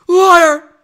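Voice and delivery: high, explosive accusing yell; acted outburst of rage; furious shout. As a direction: roar it at full volume. Acted Anakin turns on Padme.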